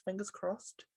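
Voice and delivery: normal talking voice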